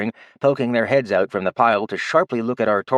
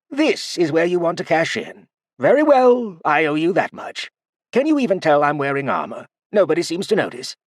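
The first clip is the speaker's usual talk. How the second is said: lightly voiced old man, a touch higher; dry and amused, giving in; wry old man; 8 semitones higher than usual. Artorian answering the big dwarf, lightly voiced and wry.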